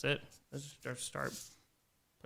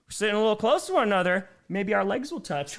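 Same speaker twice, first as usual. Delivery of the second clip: mock flirty quote; 5 semitones higher, 4 dB louder than usual. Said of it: "and it was like".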